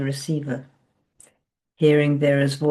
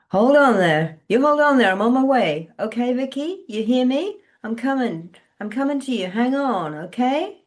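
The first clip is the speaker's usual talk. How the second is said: urgent reassurance; 7 semitones higher, 4 dB louder than usual. A narrator's reading. Faye urging her.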